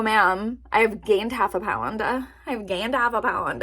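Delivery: normal talking voice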